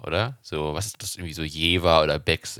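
ordinary talk, nothing marked

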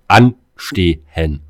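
"anstehen" drawn out very slowly and loud; stressed word. Real, not acted. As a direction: stretch "anstehen" long and hit it hard. Outraged at queueing for VIP entry.

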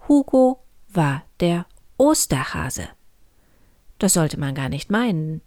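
plain reading voice

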